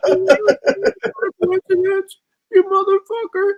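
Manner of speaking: mocking impression. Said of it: "that's exactly how he sounds".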